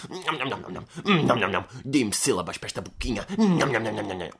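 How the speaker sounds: greedy nom-nom munching sounds; hammed-up eating noises; mock chomping. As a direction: gobble up the syllables. Mock devouring words.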